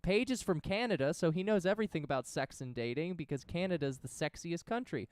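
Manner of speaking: ordinary delivery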